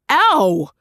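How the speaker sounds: sharp yelp of pain; short hurt "ow"; sudden pained yelp; pained cry. A narrator's reading. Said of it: "a man cried".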